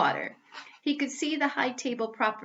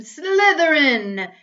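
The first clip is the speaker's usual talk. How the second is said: slow, drawn-out, much louder and higher scream; hat screaming; 6 semitones higher, 12 dB louder, much slower than usual. Acted. The Sorting Hat screams Malfoy's house at once, slowly and loudly. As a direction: stretch the name into a ringing scream. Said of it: "it screamed".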